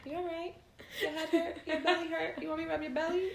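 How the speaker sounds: high-pitched imitated little girl; daughter's voice. Daughter walks up to check on mom, high child voice. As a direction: pipe up like a small child fussing over mom. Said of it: "She, she walk up on me".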